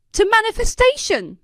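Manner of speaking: high, loud toast; shouted toast. A narrator's reading. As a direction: shout it out to the crowd. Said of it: "someone shouted".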